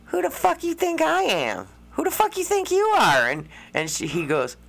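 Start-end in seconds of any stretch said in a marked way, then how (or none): 0.1-3.3 high, far put-on voice of a woman snapping back; mock outraged girlfriend, high and sharp; angry woman retold. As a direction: throw the girl's outrage back at him, pitched way up. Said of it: "and she goes".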